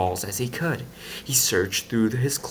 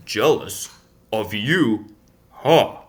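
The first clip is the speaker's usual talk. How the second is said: voiced apart, a scornful huff at the end; scoffing disbelief; scoffing mayor; 6 dB louder, slower than usual. A narrator's reading. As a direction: brush off the charge with a snort.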